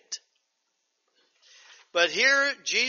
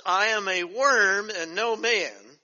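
slow, stressed quoting; emphatic quote; 3 semitones higher, 4 dB louder, slower than usual. Acted. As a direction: stress worm and draw it out.

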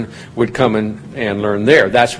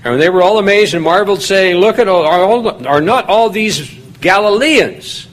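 raised, ringing Bible reading; scripture reading; 6 semitones higher than usual. Acted. Preacher reading Acts to the congregation, raised and ringing. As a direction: read the verses out to the congregation with lift.

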